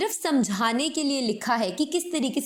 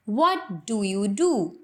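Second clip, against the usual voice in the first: every word slow and separate; Indian-style careful; 3 semitones lower, much slower than usual. Shown as a demonstration. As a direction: say each word clearly apart, slowly. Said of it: "India में हम इस statement को इस तरीके से pronounce करेंगे". (हर शब्द अलग-अलग धीरे बोलें)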